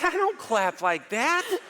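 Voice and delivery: playful defensive denial. Retold or imitated. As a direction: protest your innocence, hammed-up. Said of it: "I said".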